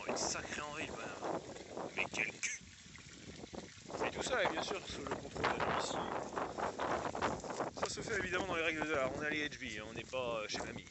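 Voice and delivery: comment quietly so nobody hears; whispered sports commentary; hushed commentary. Acted. Hushed commentary during break.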